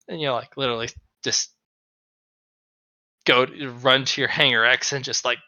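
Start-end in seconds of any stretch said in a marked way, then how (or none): none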